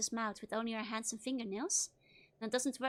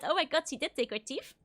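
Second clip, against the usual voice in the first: ordinary delivery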